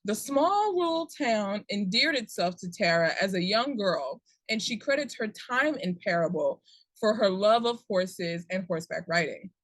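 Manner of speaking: ordinary talk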